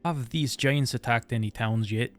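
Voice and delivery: ordinary talk, nothing marked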